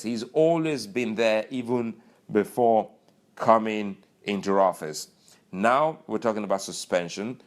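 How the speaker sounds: usual speaking voice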